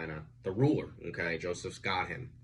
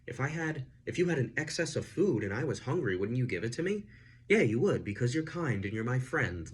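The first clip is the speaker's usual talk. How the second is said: lightly voiced smooth manipulator, higher and fast; a hint of a gaslighting host turning it around; manipulative Joseph; 6 semitones higher than usual. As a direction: imitate a guilt-tripping friend, quick and a bit higher. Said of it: "because he's like".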